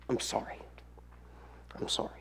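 very soft, low contrition; hushed apology. Acted. Believer confessing to God the Father, hushed. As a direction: say it almost silently, humbly.

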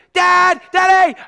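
high shouted call for dad; scared child calling. Retold or imitated. Young pastor calling his father at night, high frightened shout. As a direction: yell for daddy like a frightened boy. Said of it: "I'd say".

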